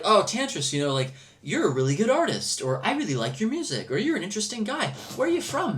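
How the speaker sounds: enthusiastic admirer. Retold or imitated. Admirers asking an artist where he's from, gushing. Imitates admirers greeting him eagerly.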